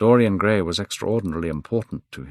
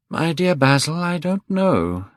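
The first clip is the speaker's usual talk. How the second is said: bored Dorian; 10 semitones higher than usual. A narrator's reading. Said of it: "He looked very bored".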